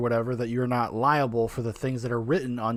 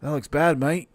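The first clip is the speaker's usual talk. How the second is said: put-on English accent drifting Australian; cockney that slides into Aussie. Practising a bad English accent for viewers. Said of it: "veers into, like, Australian there".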